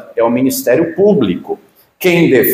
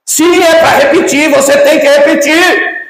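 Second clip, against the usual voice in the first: much higher and louder, imitating the furious judge; mock shout; 10 semitones higher, 4 dB louder than usual. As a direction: shriek it back at the witness. Judge yelling at witness.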